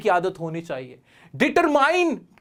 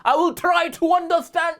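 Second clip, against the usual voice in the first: fake American accent; 9 semitones higher, much slower than usual. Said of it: "American बनने की कोशिश मत करना".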